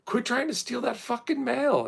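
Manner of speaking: high, pushed mock shout; mock yell back. Yelling back at the mail thieves from the yard. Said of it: "and I go".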